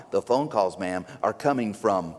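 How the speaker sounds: low, slow, ominous police voice; ominous reveal. Retold or imitated. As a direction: drag out inside the house for dread. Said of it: "police reveal in horror story".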